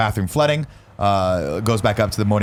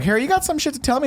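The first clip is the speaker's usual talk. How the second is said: much higher, mock wise headmaster voice; Dumbledore impression. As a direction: play a goofy old wizard asking. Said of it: "Dumbledore, of course, is like".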